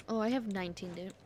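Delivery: ordinary talk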